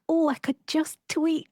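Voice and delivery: high, light, tempted voice. Retold or imitated. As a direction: sing it high like a sudden idea. Quoting own tempted thought.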